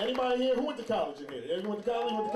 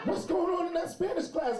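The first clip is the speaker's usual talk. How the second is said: raised-pitch, demanding scolding; angry mom; 9 semitones higher than usual. Retold or imitated. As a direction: imitate a mother demanding answers. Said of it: "she's real angry".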